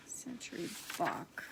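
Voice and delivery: quiet whisper to herself. Real, not acted. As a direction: murmur the name to yourself, barely voiced. Repeats the name to herself.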